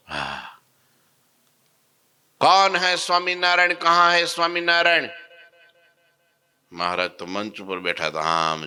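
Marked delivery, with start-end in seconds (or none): 2.4-5.1 high, loud demanding call; arrogant shout. Retold or imitated. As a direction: bellow it like a bully hunting someone. Madari arrives shouting for Maharaj.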